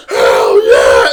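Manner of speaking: long roaring shout imitating a crowd; big drawn-out mock crowd roar; crowd's answer. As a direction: yell it back like a whole audience. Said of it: "And everyone's like".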